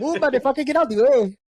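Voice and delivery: high, loud playful outburst; rowdy mock yell. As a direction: yell it high through laughter, playfully pushy. Blurts a rowdy lyric while laughing.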